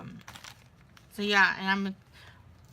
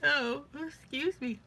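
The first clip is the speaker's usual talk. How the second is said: yawning; 6 semitones higher than usual. Real, not acted. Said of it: "I'm yawning".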